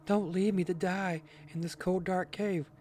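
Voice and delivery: high, pleading grandma voice. Acted. Grandma begs not to be left in the cave, pleading. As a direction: beg in a high put-on grandma voice.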